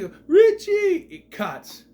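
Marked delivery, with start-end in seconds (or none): 0.3-1.1 high, loud soap-opera cry; mock anguished yell. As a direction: yell the name with hammed-up grief. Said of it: "yelling out his brother's name".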